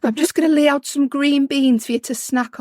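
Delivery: a hint of a Geordie mum offering snacks. Imitating her own mum in a light Geordie accent. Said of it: "I don't know why she's Geordie".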